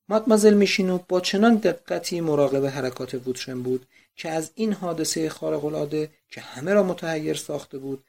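ordinary delivery, nothing marked

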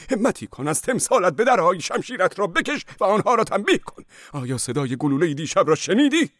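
very high, loud crazed prayer; demented monk screams to the archangel; frantic cry. Acted. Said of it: "راهب چنین فریاد زد".

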